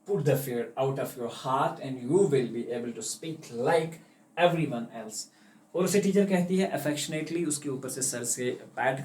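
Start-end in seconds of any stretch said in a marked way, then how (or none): 0.1-5.3 slow, lower, tender reassurance; affectionate teacher. Acted. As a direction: soothe the scared child. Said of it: "patted her affectionately".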